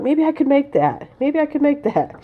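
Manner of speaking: high, eager self-quote; dreamy wishful thinking aloud; hopeful wishful. Retold or imitated. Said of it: "I'm like".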